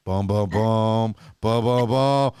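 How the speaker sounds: slow, low, looming haunted-house boom; spooky low booms. Retold or imitated. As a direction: boom it out like haunted farmhouse keys. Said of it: "a little lower toned if you're at the haunted house".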